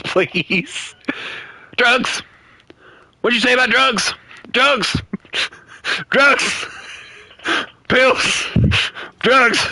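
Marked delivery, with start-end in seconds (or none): 1.8-9.6 high yelled meme quote; reference quote. Retold or imitated. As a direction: shout it like the famous clip. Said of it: "you got that reference".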